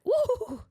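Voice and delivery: high, loud shivery woo; shuddering woo. Real, not acted. Host reacting to the accent she fears, shuddering woo. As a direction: let out a creeped-out woo. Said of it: "gives me the heebie-jeebies".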